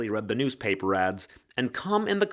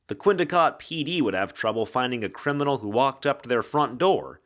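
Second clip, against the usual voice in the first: ordinary delivery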